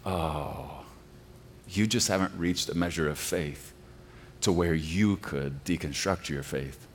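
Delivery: soft, quiet condescension; patronizing. Retold or imitated. Mimicking deconstructionists talking down to believers, quietly patronizing. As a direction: talk down gently to the believer. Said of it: "a patronizing tone of".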